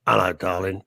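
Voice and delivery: imitating a listener's voice. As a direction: coo it like a glamorous lady. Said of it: "she probably doesn't talk like that".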